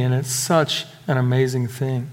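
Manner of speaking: ordinary talk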